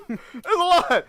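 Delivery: laughing through the words. Real, not acted. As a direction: say it while laughing. Laughing at own point.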